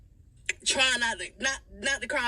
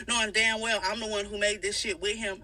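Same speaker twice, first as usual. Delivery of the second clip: ordinary talk, nothing marked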